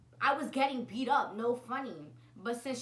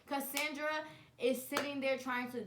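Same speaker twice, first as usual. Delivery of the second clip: ordinary talk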